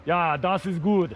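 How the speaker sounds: low, slow German accent. Shown as a demonstration. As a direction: put on a deep German voice. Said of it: "German accent".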